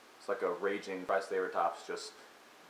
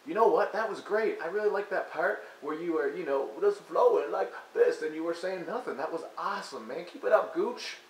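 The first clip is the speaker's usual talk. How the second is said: higher, sarcastic studio engineer gushing; mock engineer; 6 semitones higher than usual. Mocking the engineer praising [name], sarcastic gush. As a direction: fake praise, pitched up and eager.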